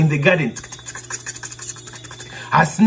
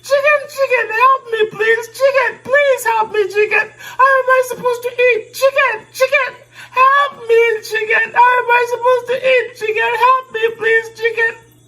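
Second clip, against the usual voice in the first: high squeaky mouse, begging urgently; tiny panicked mouse voice; pleading mouse. Acted. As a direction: beg like a frantic little mouse, very high. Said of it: "And he said".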